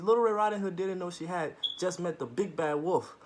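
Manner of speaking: plain reading voice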